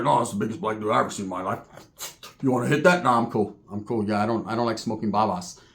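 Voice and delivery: low, soft stoned voice; stoned Roach. Retold or imitated. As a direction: mumble it low like a stoned old biker.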